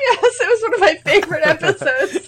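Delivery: high, loud words through laughter; laughing. Real, not acted. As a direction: squeeze it out while cracking up. Laughing over favorite old episode.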